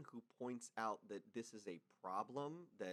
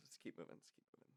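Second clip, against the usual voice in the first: quiet mutter; 7 semitones lower, 14 dB quieter, faster than usual. Real, not acted. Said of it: "repeated, trailing off".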